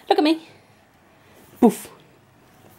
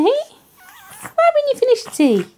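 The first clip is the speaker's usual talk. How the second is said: put-on high, slow whiny baby voice; mock whine; slower than usual. Owner teasing puppy about tea, slow high whine. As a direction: whine it like a fussy child.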